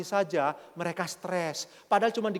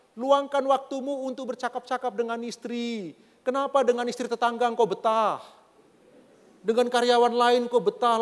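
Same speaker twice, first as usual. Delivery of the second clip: raised, high preaching voice; forceful preaching; 6 semitones higher, 5 dB louder than usual. Acted. Preacher urging husbands to talk with their wives, forceful preaching. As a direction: press the point loudly to a congregation.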